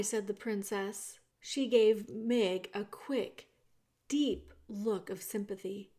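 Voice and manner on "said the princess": ordinary delivery, nothing marked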